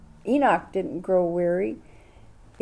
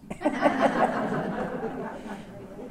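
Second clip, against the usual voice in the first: airy soundless laughter; 6 semitones higher, 4 dB quieter than usual. Real, not acted. Teasing the class about the governor, airy laugh. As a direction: laugh with no voice at all. Laughing at her own question.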